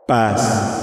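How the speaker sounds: echoing spectral voice; ghostly voice. Acted. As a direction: let it ring out through a cavern. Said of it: "su fantasmagórica voz resonó".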